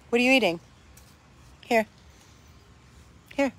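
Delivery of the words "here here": ordinary talk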